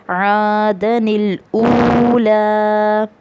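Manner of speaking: very slow, drawn-out Quranic recitation. Shown as a demonstration. Teacher reading the first example to students, slow drawn recitation. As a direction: stretch every vowel as in tajweed. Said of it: "ठीक है".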